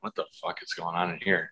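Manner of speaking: muttered under his breath at the door; hushed aside. Retold or imitated. Ex-player retelling peeking at the lit meeting room, hushed and puzzled. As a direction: keep it low, puzzled, to yourself.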